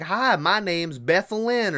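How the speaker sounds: a hint of a sweet old lady, drawn out. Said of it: "in old lady voice".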